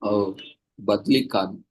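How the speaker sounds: ordinary talk, nothing marked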